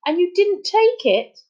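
high-pitched incredulous question; shocked. A narrator's reading. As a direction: react in disbelief, voice shooting up.

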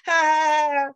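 loud, high mock cackle at the fallen; mocking taunt laugh. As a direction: taunt them with a fake ha ha.

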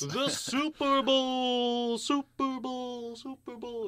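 high, slow words fading into echo; echo effect. Acted. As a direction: announce it with a big echo. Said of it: "our newly installed Echo pedal".

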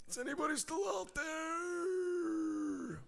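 slow high call that fades; fading echo call. Pretend. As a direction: let it trail off like a distant echo. Calling out to silent audience.

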